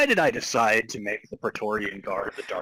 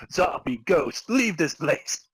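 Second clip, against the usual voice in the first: rising, louder banishing shout, hammed up; mock exorcist command; 6 semitones higher, 5 dB louder, slower than usual. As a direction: order the ghosts out like a cheesy exorcist. Retold command to ghosts.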